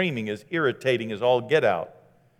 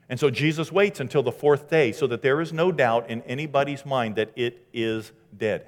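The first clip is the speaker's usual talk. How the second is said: normal talking voice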